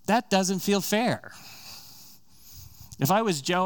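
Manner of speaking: ordinary talk, nothing marked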